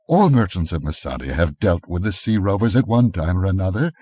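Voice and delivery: ordinary delivery, nothing marked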